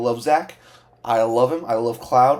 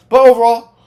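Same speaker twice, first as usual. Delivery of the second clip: sudden loud high burst; loud outburst; 8 semitones higher, 12 dB louder than usual. Real, not acted. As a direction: blurt it out loudly.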